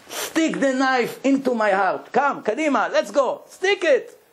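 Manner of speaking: anguished plea. Retold or imitated. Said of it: "Started to scream, to cry".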